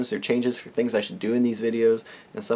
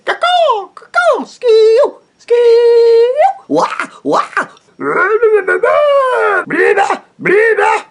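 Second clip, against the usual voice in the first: squawking cries, much higher and louder; weird bird noises; 12 dB louder, much slower than usual. Retold or imitated. Squawking at the raccoon to scare it off the chicken. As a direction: screech like a wild bird to scare it off. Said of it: "making weird noises".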